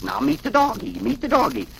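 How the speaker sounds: put-on girlfriend introducing the dog. A narrator's reading. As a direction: lift the pitch to present the dog.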